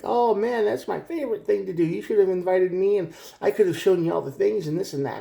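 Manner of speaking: mock gushing. Imitating the clingy person who wants to be invited, high and gushing. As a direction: gush like the clingy friend who missed out.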